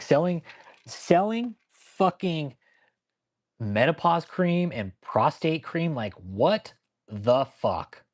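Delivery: high, slow disbelief; high and drawn out, what the fuck; incredulous outrage. Real, not acted. Outraged at the cream selling.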